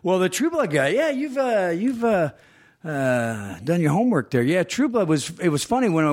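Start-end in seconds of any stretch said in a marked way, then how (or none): 0.0-4.4 a hint of high Southern twang. Southern voice for True Blood.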